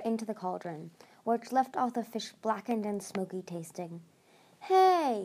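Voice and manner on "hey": high-pitched protesting "hey". A narrator's reading. Tsunami objects to her fish being cooked, protesting. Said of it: "Tsunami protested".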